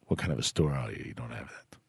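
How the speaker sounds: very low, hushed put-on caller accent. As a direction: drop deep and quiet in a mock caller's accent. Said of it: "That was a weird transition of your accents".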